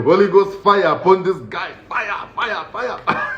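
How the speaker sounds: high hushed fire fire; hammed-up preacher casting fire; mock fiery prayer. Comic praying Holy Ghost fire at the man, mock preacher.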